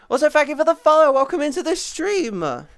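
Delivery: high, loud, fast welcome; cheerful streamer welcome; excited greeting. Acted. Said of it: "streamer greeting new follower".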